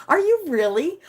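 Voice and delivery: delighted disbelief; excited squeal. Real, not acted. Streamer reacting to a local viewer, excited squeal.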